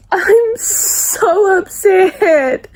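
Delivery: very slow, high, tearful; drawn out through tears; crying. Real, not acted. Grieving a killed character.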